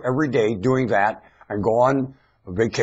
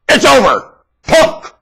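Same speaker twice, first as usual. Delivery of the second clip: defiant shout; 17 dB louder, slower than usual. Real, not acted. Ranter taunts in Bush's name, defiant shout. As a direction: yell it high and loud.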